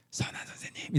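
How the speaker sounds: soft, fawning whisper; flattering whisper. Retold or imitated. Rich official flattering Jesus to get an answer, whispered. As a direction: breathe it like sweet-talking him. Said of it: "이렇게 부른 이유가".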